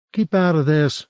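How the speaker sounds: a touch of a snap at a meddler; snapped. A narrator's reading. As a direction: Adriana telling Cornelius to butt out, sharp. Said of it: "Adriana snapped".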